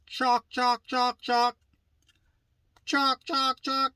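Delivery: high-pitched, evenly repeated "chalk" like a yelping turkey; mimicked turkey yelp. Shown as a demonstration. Teacher showing how to yelp on a mouth call, high turkey mimicry. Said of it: "mimic whenever you say or make the sound".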